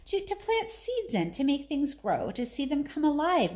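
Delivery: put-on hesitant, halting girl's plea; faltering. A narrator's reading. Mary falters answering Mr Craven, hesitant. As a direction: stumble shyly over the words. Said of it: "Mary faltered".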